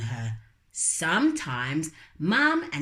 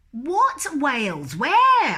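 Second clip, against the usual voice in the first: higher, louder alarm; startled brother; 8 semitones higher, 7 dB louder, slower than usual. Acted. Brother startled by imaginary whales, loud. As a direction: jump in mock alarm.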